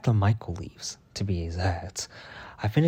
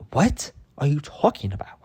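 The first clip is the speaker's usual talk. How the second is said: higher-pitched reenacted disbelief; incredulous; 5 semitones higher, 3 dB louder than usual. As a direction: challenge her, word by word. Retold disbelieving reply to coworker.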